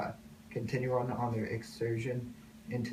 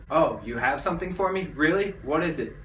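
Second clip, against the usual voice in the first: loud, higher, eager questioning; excited surprise; 5 semitones higher, 9 dB louder than usual. Acted. President hearing they have something for him, loud and excited. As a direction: burst out with rising eagerness.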